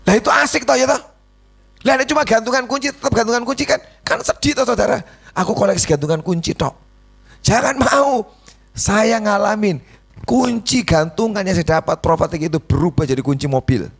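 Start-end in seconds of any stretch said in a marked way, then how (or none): 0.0-8.3 mock pity. Preacher teases the congregation about keychain keys, high and mocking.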